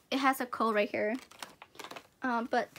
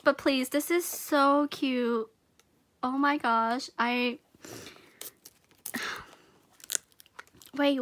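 normal talking voice

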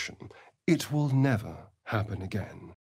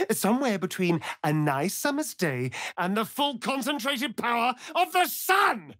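mock dramatic rise; 9 semitones higher than usual.

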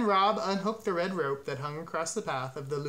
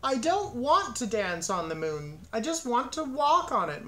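wailing; 7 semitones higher than usual. A narrator's reading. Cow protests to her farmer at the show, wailing. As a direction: whine it out, climbing higher. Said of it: "Martha wailed".

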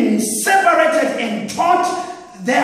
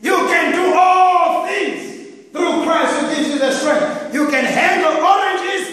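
higher, shouting preacher's roar; full-force preaching; 4 semitones higher than usual. Acted. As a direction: thunder the promise out over the crowd.

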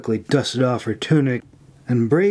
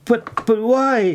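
much higher, slow, stammering shock; shocked gasp; 10 semitones higher, slower than usual. A narrator's reading. May reacts to Cora's cruelty, shocked. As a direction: stumble out the question in shock. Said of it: "she gasped in shock".

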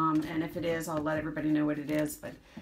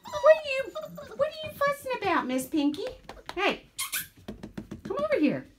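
high soothing puppy talk. Real, not acted. Talking to fussing puppy.

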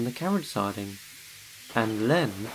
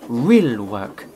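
muttering but louder; a louder angry grumble; 2 semitones lower, 7 dB louder than usual. A narrator's reading. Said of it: "muttered Gordon".

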